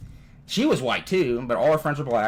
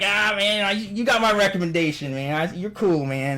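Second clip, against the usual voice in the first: higher, hearty buddy voice; friendly dude; 6 semitones higher than usual. Retold or imitated. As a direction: lightly imitate an approving pal.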